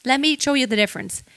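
ordinary talk, nothing marked

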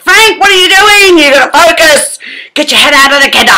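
high, loud mock scolding; telling off. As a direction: scold her to focus, hammed-up. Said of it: "I just sort of said".

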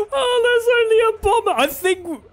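very high, squealing through laughter; hysterical, high-pitched amazement; ecstatic disbelief. Real, not acted. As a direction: shriek it, overcome with glee. Amazed at wiping out the team.

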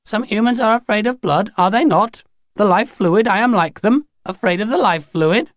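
put-on high, unstrained old lady; old-ladyish Grinny. A narrator's reading. Grinny explains her fear of the life fluid, unstrained and old-ladyish. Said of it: "sounded so ordinary and old ladyish and unstrained".

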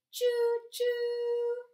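high, loud choo choo like a steam whistle; train whistle call. A narrator's reading. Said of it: "Papa Pufferbelly cheered".

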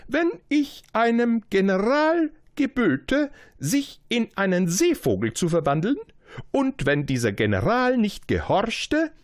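a proud king beckoning his subject. Acted. King's saying.

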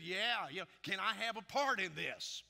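loud, eager mock volunteering; acted gung-ho excitement, loud; eager Moses. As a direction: clamour to join in, hammed up. Voices Moses eager to join in.

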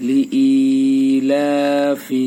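লি ইলাফি with the ই and the লা stretched very slowly; sing-drawn লি ইলাফি, long ই and long লা; madd lengthening. Shown as a demonstration. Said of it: "এই যে হামজা একালিপ টান, লামে একালিপ টান". (লি ইলাফি হামজা ও লামে টান)

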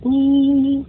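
a high, squealing ooh of delight; excited ooh. Real, not acted. Vlogger coos over cute things, high excited ooh. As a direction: squeal it like something cute just caught your eye. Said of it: "gets me excited".

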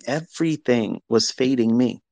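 ordinary talk

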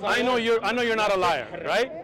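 ordinary talk, nothing marked

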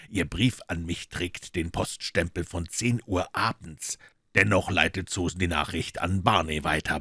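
cool detective teasing the maid; dry detective. Acted.

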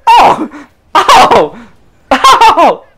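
high, loud, climbing laughing oh's; rising excited cries. Real, not acted. Player watching each roll fail, climbing excited cries. Watching repeated dice losses.

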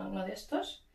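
ordinary talk

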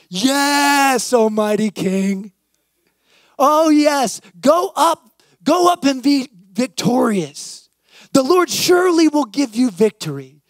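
mock-reverent, sneering yes to the king; sarcastic. A narrator's reading. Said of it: "Micaiah replied sarcastically".

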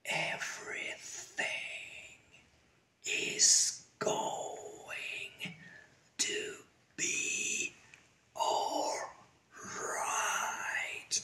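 slow whisper, every word drawn out; very quiet. Shown as a demonstration. Teacher lets learners say it aloud over her, whispering. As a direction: whisper it slowly so others can be heard. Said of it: "Yo lo digo bajito".

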